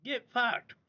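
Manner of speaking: scornful dismissal; dismissive. Real, not acted. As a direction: toss it off again.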